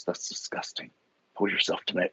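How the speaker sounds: soft unvoiced scolding whisper; whispered bucket voice. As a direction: hiss the scolding like a tiny bucket. Talking bucket scolds Norm.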